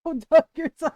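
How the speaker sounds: loud exasperated laughing; exasperated outburst. Real, not acted. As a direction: burst out in frustration. Outburst at the ordeal.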